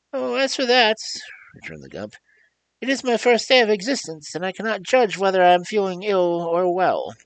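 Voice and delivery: very high, loud squeaky voice of a huge beast; squeaky creature voice. Acted. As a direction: squeak like a tiny thing in a giant body. The Gump answers.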